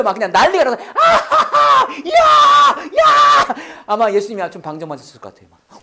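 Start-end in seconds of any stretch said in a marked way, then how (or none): 0.9-3.5 high, loud whooping laughter imitation; bursting with joy. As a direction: act out an uproarious, overjoyed outburst. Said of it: "예수님 막 빵 터졌어요". (빵 터져서 환호하는 웃음 흉내)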